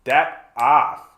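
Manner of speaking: loud, slow territorial put-down; hammed-up tough refusal; possessive United States. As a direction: play a jealous bully staking a claim. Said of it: "the United States is basically just going".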